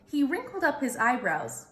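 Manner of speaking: usual speaking voice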